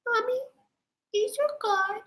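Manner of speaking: high mock-child voice; little kid voice. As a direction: pipe it up like a small child. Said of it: "the kid's gonna be like".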